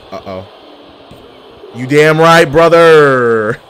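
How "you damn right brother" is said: loud, triumphant cheer; pumped-up gamer yell; hyped shout. Real, not acted. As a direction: holler it in celebration. Celebrating a move in game.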